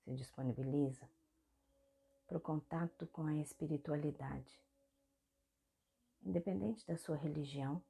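soft meditation guide with long pauses; guided meditation. Real, not acted. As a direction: guide the body gently through each movement, leaving space between phrases. (meditação guiada, voz suave e pausada)

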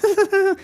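high little snigger; pig sniggering. Acted. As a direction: snicker at the beaten wolf. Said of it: "a little sniggering pig".

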